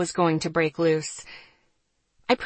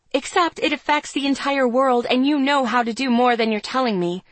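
higher, insistent rebuttal; countering; 6 semitones higher, 4 dB louder than usual. A narrator's reading. Narrator accusing Serena of holding back, heated countering. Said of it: "I countered".

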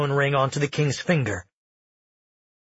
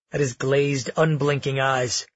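plain reading voice

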